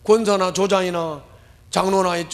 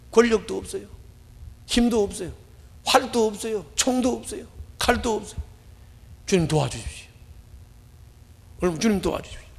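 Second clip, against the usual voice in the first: confessing helplessness to God; prayer; 3 semitones higher than usual. Acted. Preacher recites his own prayer.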